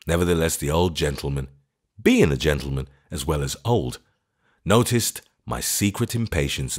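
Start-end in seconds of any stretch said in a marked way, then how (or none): none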